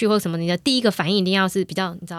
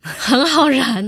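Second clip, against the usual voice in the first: very slow, higher, louder echo; echoing mock; 5 semitones higher, 5 dB louder, much slower than usual. Host echoes his line back, slow and higher. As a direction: repeat it back teasingly.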